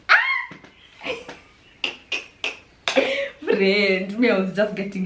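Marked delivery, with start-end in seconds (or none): 0.1-1.0 sudden very high, loud "Ah!"; shocked shriek. Real, not acted. Shrieking in shock at what the friend just said. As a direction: let out a startled shriek. Shocked outcry at his remark.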